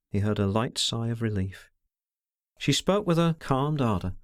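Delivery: plain reading voice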